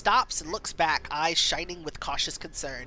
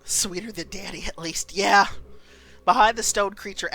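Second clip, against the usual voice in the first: ordinary delivery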